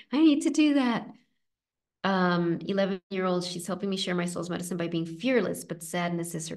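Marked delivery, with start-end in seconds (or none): 0.1-1.1 put-on eager inner child. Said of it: "You know, like".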